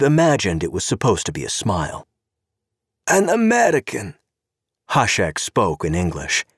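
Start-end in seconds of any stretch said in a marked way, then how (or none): 3.1-4.2 high, purring voice of a sharp-clawed society woman, mocking; sweetly catty, like an angry cat smiling; sly socialite. Zorena voiced by narrator.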